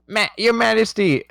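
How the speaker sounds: high, pleading yelp; a servant begging as he's dragged; desperate cry. Acted. Card dragged off crying out to the Queen, high and desperate.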